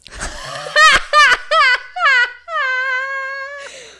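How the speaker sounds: high, loud laugh trailing out of breath; real tearful cackling burst; helpless laughing fit. Real, not acted. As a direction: laugh until the air runs out. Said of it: "I'm laughing so hard".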